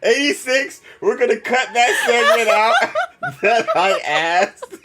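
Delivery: high, loud, words broken by laughter; real embarrassed giggles; laughing embarrassed. Real, not acted. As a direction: cut it out while cracking up. Embarrassed laughing, cut segment.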